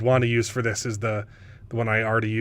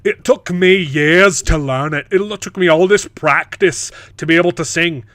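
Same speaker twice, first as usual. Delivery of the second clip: mock-indignant jealous character, louder; jealous complaint; 3 semitones higher, 7 dB louder than usual. Voicing her jealousy of his power, mock-indignant. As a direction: drag out the aggrieved complaint.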